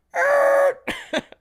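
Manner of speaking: a shrill frightened yelp; high-pitched squeal. Retold or imitated. Imagining seeing his fighting stance, a scared squeal. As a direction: squeal like you just saw his stance.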